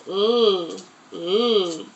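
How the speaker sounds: stretched ooh sound for the letter; drawn-out "Ooh" like an o vowel; sounding out words. Shown as a demonstration. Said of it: "we sound out the word log".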